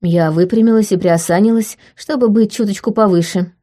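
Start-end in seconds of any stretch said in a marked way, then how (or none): none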